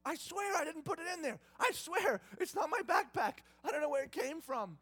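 high, loud, desperate swearing; panicked brother protesting, high and loud; frantic denial. Retold or imitated. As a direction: plead your innocence at full pitch. Brother denying stolen cup.

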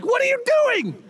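high, loud outraged protest; a king scolding his prophet; king berating Balaam, outraged; irate king. Retold or imitated. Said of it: "and the king's like".

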